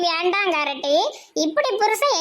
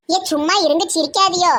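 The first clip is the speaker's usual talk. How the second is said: ordinary talk, nothing marked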